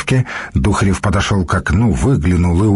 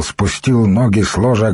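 plain reading voice

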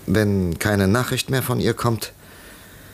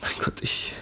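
verzweifeltes Flüstern; 9 dB quieter than usual. Acted. Ackroyd verzweifelt, erschüttert.

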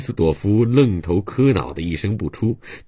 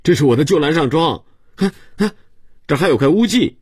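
put-on very high, louder child's voice; insistent little boy; 11 semitones higher, 4 dB louder, faster than usual. A narrator's reading. Boy proving the jacket was his, high and insistent. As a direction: pipe it up high, pointing out proof.

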